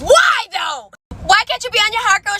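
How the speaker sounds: hammed-up exasperated shout; exasperated cry. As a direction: wail the question in mock despair. Exasperated rebuttal to the claim.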